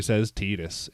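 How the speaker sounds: "Tidus" with an ee, like tea; first syllable of "Tidus" rhymes with tea; TEE-dus pronunciation. Shown as a demonstration. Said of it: "American trailer".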